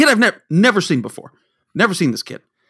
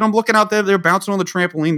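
ordinary talk

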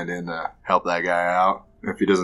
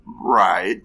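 normal talking voice

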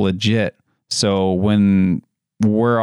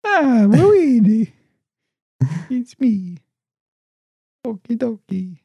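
very high, cartoon video-game hero voice; Mario; 13 semitones higher, slower than usual. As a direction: squeak it out in a high falsetto, playfully. Mimics Mario after naming him.

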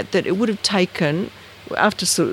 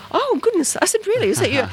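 much higher, starstruck gush; 13 semitones higher than usual. Retold or imitated. Student gushing to the sea level expert on the phone. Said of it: "I didn't mean to gush".

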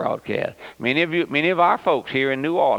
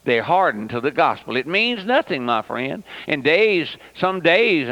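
ordinary delivery

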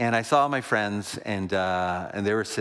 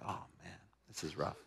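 much softer, lower dismayed aside; muttered to himself; 4 semitones lower, 16 dB quieter than usual. Retold or imitated. Pastor recalling his private dismay in the hostile bar, hushed. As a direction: say it under your breath, dismayed.

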